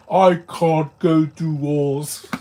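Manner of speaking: loud, slow, ridiculous villain voice; mock villain voice. Mocking how the Spot doesn't sound in the movie, mock villain voice. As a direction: boom each word out slowly as a silly villain. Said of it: "He doesn't sound like".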